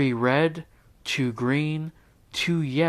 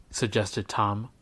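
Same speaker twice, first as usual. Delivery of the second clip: ordinary delivery, nothing marked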